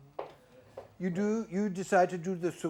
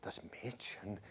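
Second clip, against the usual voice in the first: low, soft storyteller voice; narrative tone; 10 semitones lower, 18 dB quieter than usual. Shown as a demonstration. Coach showing the singer a more narrative colour, storytelling. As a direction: begin it like a quiet tale. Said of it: "I think more narrative".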